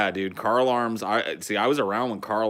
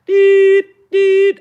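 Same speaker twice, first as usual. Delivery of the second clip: loud, high, even beeps; imitated truck beeper; 17 dB louder than usual. Describing trucks below the apartment, piercing reversing beeps. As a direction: beep like a truck backing up. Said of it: "that fucking truck sound when they back up".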